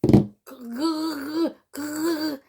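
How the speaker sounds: throaty gargling noise; imitated gargle. Mimicking gargling salt water at the altar, throaty.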